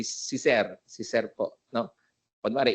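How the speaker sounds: normal talking voice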